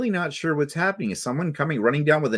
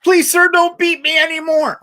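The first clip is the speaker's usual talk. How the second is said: high, loud hammed-up begging; mock pleading kid; 14 semitones higher, 9 dB louder than usual. Pupil begging the teacher, hammed-up high plea. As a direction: beg like a terrified schoolkid. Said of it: "their kind of yelling wasn't necessarily positive".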